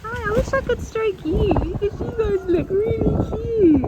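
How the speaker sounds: high, quick, excited coo; gushing animal talk. Real, not acted. Visitor wishing to stroke cute animals, gushing high. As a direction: gush fondly at cute animals.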